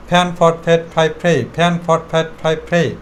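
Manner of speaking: level, run-together word list; quick drill. Shown as a demonstration. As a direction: rattle the list off without stopping. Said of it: "একটু দ্রুত বলার চেষ্টা করতে হবে".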